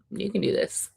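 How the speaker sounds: whispered encouragement; softly whispered pep talk; mock whisper. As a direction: breathe it like a gentle nudge. Encouraging a grown kid, acted.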